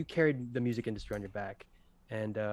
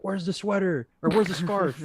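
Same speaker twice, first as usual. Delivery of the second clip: high-pitched, raised-voice mock outrage; mock scream; 7 semitones higher, 6 dB louder than usual. Complaining to sister about Jake Gyllenhaal, mock scream. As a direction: yell it higher and louder, half joking. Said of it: "or I scream to my sister".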